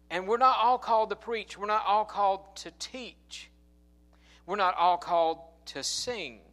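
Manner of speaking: loud, high sermon cadence, hammering each line; preaching at full force. Acted. Preacher driving the point home to his congregation, full-force preaching. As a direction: lift the room with each repeat.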